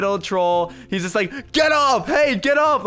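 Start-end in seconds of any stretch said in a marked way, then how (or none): 1.5-2.8 high, loud imitation of shouting; mock angry yelling. Gamer imitating the player he trolls, mock yelling. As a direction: yell it as the annoyed player would.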